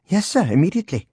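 quick, high, nervous compliance. A narrator's reading. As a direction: answer hurriedly, eager to obey. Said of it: "steward's head bobbed nervously".

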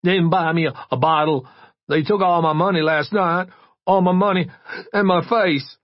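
high, distraught complaint; acted drunken self-pity; tearful drunk. A narrator's reading. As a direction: wail it pitifully, pitch rising to the end. Sobbing drunk keeps pleading.

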